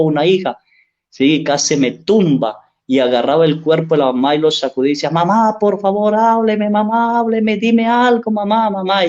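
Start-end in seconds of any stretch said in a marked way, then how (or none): 5.1-9.1 high wailing cry of a grieving daughter; crying plea. Retold or imitated. Daughter pleading with her dead mother, high and tearful. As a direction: beg through tears, voice pitched high. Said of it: "y lloraba y clamaba".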